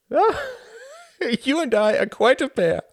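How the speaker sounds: high, loud laughing exclamation; amused delight; laughing cry. Acted. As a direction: cry it out, still giggling. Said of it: "cried Klaus".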